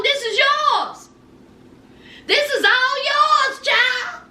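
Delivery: high, slow imitation of the daddy; daddy pleading. Daddy pleading with Brandon, high and slow. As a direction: stretch out each word like a father pleading. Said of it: "And he's like".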